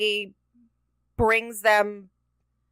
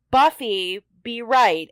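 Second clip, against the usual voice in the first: normal talking voice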